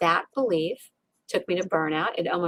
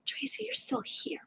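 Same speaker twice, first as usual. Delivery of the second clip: tender; 13 semitones higher, 14 dB quieter than usual. Retold or imitated. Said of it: "my heart's saying".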